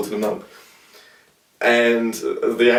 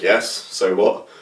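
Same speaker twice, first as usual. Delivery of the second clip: put-on old man's retort; much slower than usual. Old man in a retold story.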